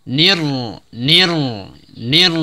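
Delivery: nir repeated, nun sliding into pressed ra; tajwid drill. Shown as a demonstration. Teacher repeats nir for students, pressed ra. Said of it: "ditekan langsung dari nun kasroh".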